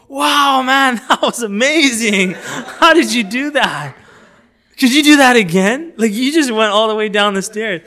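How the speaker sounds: high, loud awe at a stunt; big amazed enthusiasm. Acted. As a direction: gush as if it were an amazing feat. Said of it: "you come out with such emotion".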